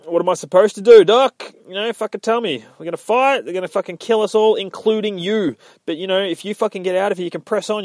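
high, urgent, rattled rush; agitated pleading. Retold or imitated. Yorick tells Doc they're gonna kill us all, agitated pleading. Acted desperate plea to Doc.

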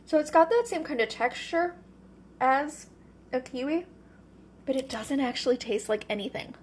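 talking with a mouth full; chewing while talking, mouth full. Real, not acted. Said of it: "with my mouth full".

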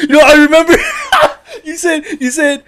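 high, loud, laughing through the words; cracking up. Real, not acted. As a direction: struggle to speak through helpless laughter. Cracks up recalling an embarrassing remark.

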